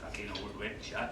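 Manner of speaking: muttered insult behind the nurse's back. Acted. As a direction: mutter it so the nurse can't hear. Grumbling after apologising to nurse.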